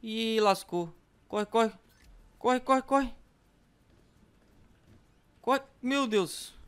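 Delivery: panicked high yelps; fleeing in a panic; being chased. Real, not acted. Zombies chasing, yelling run.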